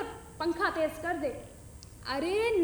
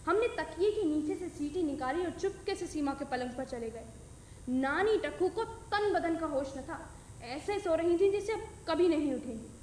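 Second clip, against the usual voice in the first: usual speaking voice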